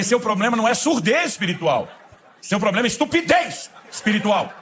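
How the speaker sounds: hammed-up scolding with a laugh; laughing loud punchline. Pastor laughing as he scolds the sleepy man, loud.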